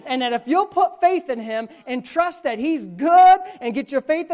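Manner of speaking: loud, ringing preacher's cadence, slowed on key lines; full-throated pulpit preaching; preaching at full force. Acted. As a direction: proclaim it to the whole church. Preaching to congregation.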